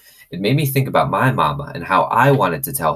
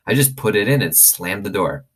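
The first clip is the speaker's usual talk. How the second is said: usual speaking voice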